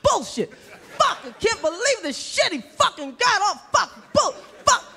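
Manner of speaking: high, outraged mock ranting. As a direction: splutter in high-pitched disbelief.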